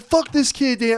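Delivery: very high, squeaky retort; mock kid comeback. Loudmouth kid answering the bully, high squeaky comeback. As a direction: snap back like a mouthy kid. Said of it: "I would've been like".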